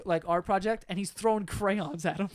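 usual speaking voice

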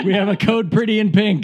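mock store announcement. Clerk alerting staff to her, mock announcement.

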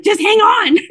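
high, loud mock hold-on; teasing a friend by copying her; imitating Annie. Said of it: "you're like".

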